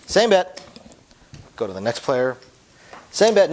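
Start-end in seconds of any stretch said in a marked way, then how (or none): 0.1-0.6 "Same bet." called out loud, falling firmly on "bet"; very loud statement. Shown as a demonstration. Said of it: "make it a very loud statement". 3.1-3.5 "Same bet." called out loud, falling firmly on "bet"; very loud statement. Shown as a demonstration. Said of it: "make it a very loud statement".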